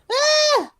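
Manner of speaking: high mock yelp; sudden high-pitched cry; crowd member's yelp. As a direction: squeal like a put-on-the-spot audience member. Acting out crowd member's shout.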